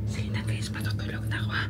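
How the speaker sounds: quiet whispered goodnight; whispering. Real, not acted. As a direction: hush it, people sleeping. Whispering goodnight near sleepers.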